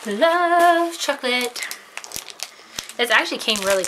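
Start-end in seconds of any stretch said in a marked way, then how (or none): 0.1-1.7 high and slow, savoring the words; excited. Real, not acted. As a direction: gush about it, stretched out. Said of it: "And I'm excited".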